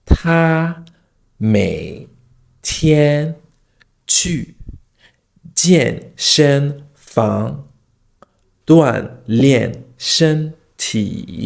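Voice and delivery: 他每天去健身房锻炼身体 syllable by syllable, pauses between, tones drawn out; exaggerated tone drill. Shown as a demonstration. Teacher demonstrating tone practice, exaggerated tones one syllable at a time. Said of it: "demonstrate what I'm trying to say".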